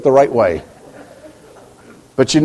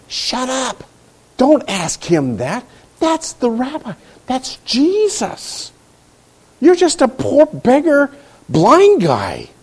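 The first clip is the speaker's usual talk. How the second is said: a hint of higher, hushed scolding bystanders; scolding crowd; 7 semitones higher, slower than usual. Bystanders hushing the blind beggar, lightly voiced. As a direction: shush him like a disapproving crowd. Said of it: "He heard the other people going".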